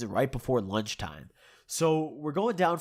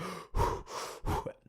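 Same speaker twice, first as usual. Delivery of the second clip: heavy, voiceless huffing; imitated heavy breathing; 7 dB quieter than usual. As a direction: mimic someone breathing really heavily. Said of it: "he's breathing really heavily".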